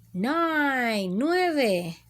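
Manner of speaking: ordinary talk, nothing marked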